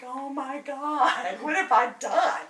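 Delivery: slow, drawn-out mock horror; mock dismay. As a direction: play it up as if you broke the store. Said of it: "And so I'm like".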